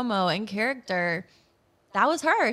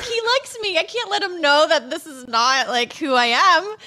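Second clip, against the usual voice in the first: mock panic; 8 semitones higher, 7 dB louder than usual. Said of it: "I was like".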